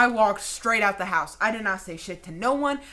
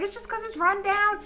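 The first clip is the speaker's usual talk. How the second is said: much higher, teasing sibling voice; mocking siblings; 13 semitones higher than usual. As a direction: whine it up high like a dismissive sibling. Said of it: "But they're like".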